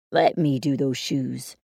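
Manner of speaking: dad voice. Acted. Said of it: "my dad said quickly".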